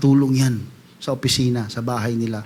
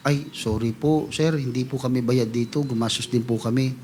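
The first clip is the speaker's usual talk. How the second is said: meek apology; 6 semitones lower, 10 dB quieter than usual. Retold or imitated. Volunteer usher apologizing to the complaining member, soft and humble. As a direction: say it softly, bowing your head.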